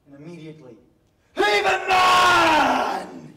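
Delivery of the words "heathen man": put-on slow, loud, higher bellow of an angry old farmer; mock bellow of a furious farmer; old man shouting. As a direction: hurl the words like an outraged old man. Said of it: "he shouts".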